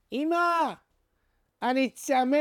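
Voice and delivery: put-on high calling shout of a thirsty boy; child shouting. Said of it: "וצועק בקול גדול".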